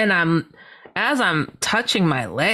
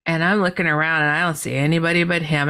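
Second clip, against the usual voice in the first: normal talking voice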